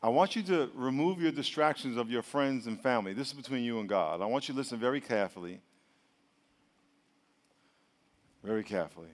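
low, hushed pastoral appeal; altar call. Real, not acted. Preacher asking bowed heads to listen, low solemn altar call. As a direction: guide them gently and soberly toward prayer. Leads congregation into prayer.

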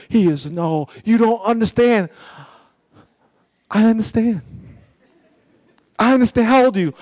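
ordinary talk, nothing marked

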